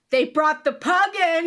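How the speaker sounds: high, loud fangirl cheer; excited shouting. Real, not acted. Fan announcing the pug's casting, excited shouting. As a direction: shout the news, thrilled. Thrilled about new cast member.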